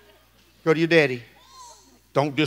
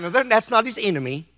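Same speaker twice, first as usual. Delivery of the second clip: normal talking voice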